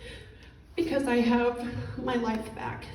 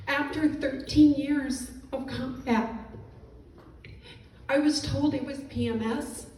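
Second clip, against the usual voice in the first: ordinary delivery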